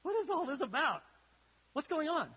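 a daughter losing patience, what is all this; exasperated demand. Retold or imitated. Daughter demanding her dad explain, exasperated. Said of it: "And then she goes".

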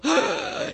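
loud, high mock gasp; hammed-up gasp of alarm; shocked gasp. King seeing the writing on the wall, shocked gasp.

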